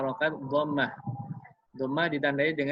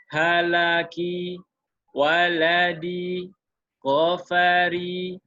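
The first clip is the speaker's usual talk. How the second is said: very slow, each syllable even and a bit louder; slow demo reading; 3 semitones higher, 3 dB louder, much slower than usual. Shown as a demonstration. Teacher demonstrates practice words for students, very slow and even. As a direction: stretch every word out evenly like a model reading. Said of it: "saya contohkan".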